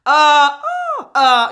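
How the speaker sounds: loud, cracking "uh" flips; voice break. Shown as a demonstration. Teacher shows students the weird break to avoid. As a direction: show an ugly register break. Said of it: "this weird break where it's like".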